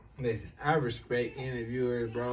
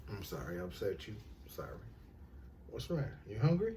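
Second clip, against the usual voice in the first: much softer, lower, tender apology; 3 semitones lower, 12 dB quieter, much faster than usual. Real, not acted. Father quietly soothes his upset baby daughter.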